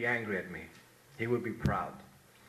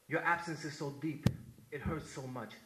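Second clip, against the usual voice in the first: poem recitation; 5 semitones lower than usual. Acted. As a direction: read it like a eulogy for a fallen brother.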